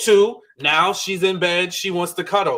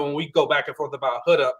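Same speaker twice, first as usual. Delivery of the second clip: normal talking voice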